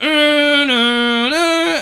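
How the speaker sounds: high, loud wailing mimic of creepy violin music; imitating eerie violins. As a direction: wail it like a horror-film string section.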